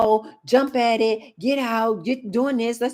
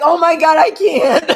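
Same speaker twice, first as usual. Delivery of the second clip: loud, higher, laughing "I can't"; laughing exasperation; 8 semitones higher, 8 dB louder than usual. Real, not acted. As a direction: burst out laughing in disbelief.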